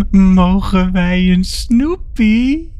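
put-on high, very slow sing-song child voice; drawn-out, loud childlike begging; childish little voice. A narrator's reading. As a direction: ask like an eerie little child, stretching the words. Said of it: "op een kinderlijk stemmetje".

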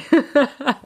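high burst of laughter; laughing. Real, not acted. Rueful about her struggles, laughing. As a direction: laugh at your own struggle.

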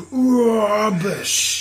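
big, slow, high "Rubbish" swung like a hook; heavy hook punch. Shown as a demonstration. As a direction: wind up "Rubbish" like a knockout punch. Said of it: "Good big, heavy fucking fight night round three".

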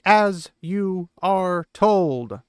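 slow and stern, each word set down; a drawn-out stern command. Retold or imitated. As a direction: lay down the law, no arguing. Nynaeve laying down the law.

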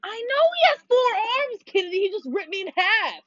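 high, stretched-out indignant wail; high-pitched protest. Real, not acted. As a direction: wail about an unfair game death. Protests being killed in game.

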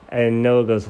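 plain reading voice

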